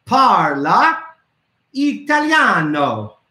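"Parla" then a pause, then "Italiano" drawn out slowly; teacher slowly modeling "Parla Italiano" for kids to copy; slow Italian phrase. Shown as a demonstration. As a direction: break "Parla Italiano" into two slow pieces. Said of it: "this is how you say".

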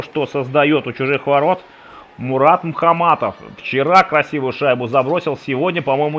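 usual speaking voice

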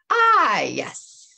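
a sly, drawn-out Ah. A narrator's reading. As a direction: sly voice creeping up close. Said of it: "said a sly voice".

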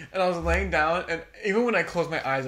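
ordinary talk

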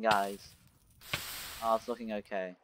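ordinary talk, nothing marked

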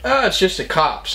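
teen shrugging off cops arriving, mock breezy; mock casual. Teen casually announcing cops to party. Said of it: "And I was like".

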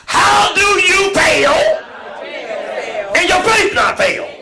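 high, loud preached question; preaching shout. Real, not acted. Preacher shouting the question to the congregation. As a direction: cry it out to the church.

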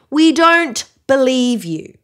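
put-on loud, slow taunting boys; mocking cry. A narrator's reading. Boys taunting Mary Elizabeth, slow mocking cry. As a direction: boys jeer at her, drawn out. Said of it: "they cried".

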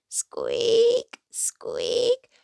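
high thin creaky squeak; little creaking squeaks; squeaking lanterns. A narrator's reading. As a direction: imitate swinging lanterns squeaking. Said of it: "went the lanterns".

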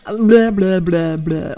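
loose blah blah warm-up; tongue exercise. Shown as a demonstration. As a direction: flap the tongue through blah blah. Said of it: "like a tongue exercise".

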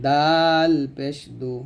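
দাল পেশ দু drawn out with the tongue tip; slow recitation drill; slow demo syllable. Shown as a demonstration. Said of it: "জিহ্বার আগাটা উপরে দাঁতে গোড়ায় লাগবে".

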